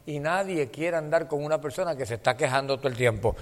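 ordinary talk, nothing marked

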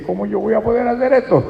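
high-pitched imitation of a worrier; acted doubter. Preacher voicing someone who doubts they can cope, high-pitched. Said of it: "A veces dice".

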